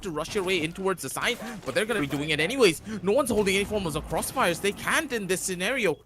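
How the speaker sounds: fast, loud hype commentary; esports caster. Real, not acted. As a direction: build the excitement as the fight collapses. Hyped caster analysis.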